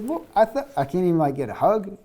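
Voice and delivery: high-pitched, mildly nervous protest; a little nervous. Acted. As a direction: play a slightly flustered, hesitant objection. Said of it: "maybe he was a little nervous".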